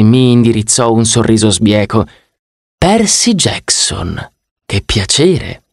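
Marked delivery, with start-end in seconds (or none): 2.8-5.6 smug drawl. Acted. The god greeting Percy, drawling "Percy Jackson" very slowly. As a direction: savour "Percy Jackson" slowly with a crooked smile.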